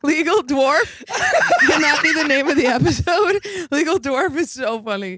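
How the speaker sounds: cracking up at a name; laughing. Real, not acted.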